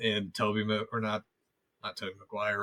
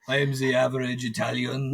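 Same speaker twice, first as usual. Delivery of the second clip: lightly put-on French accent; a hammed-up French accent posing as Italian; mock French accent. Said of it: "even though that's my French accent".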